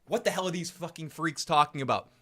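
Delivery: normal talking voice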